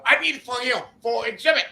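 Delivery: high-pitched caller impression; hammed-up foreign customer, pitched high; imitated foreign buyer. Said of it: "He was like".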